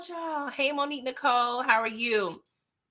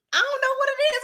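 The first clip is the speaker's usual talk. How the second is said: high, breathy-free falsetto gush; giddy falsetto repeat; swooning; faster than usual. Pretend. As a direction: gush like you're smitten. Swooning over gun cleaning.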